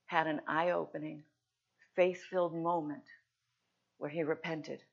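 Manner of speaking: ordinary talk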